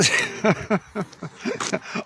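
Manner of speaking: breathless stifled laugh; airy giggle. Real, not acted. Remembering his mate on the slope, an airy giggle. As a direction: giggle helplessly at the memory.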